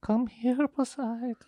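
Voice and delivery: high, soft spooky beckoning; eerie female voice. Acted. Friend imitates the female voice luring the dreamer, high and eerie. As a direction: call softly from afar, pitched high. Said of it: "It was a female, but was it eerie?".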